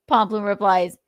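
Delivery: normal talking voice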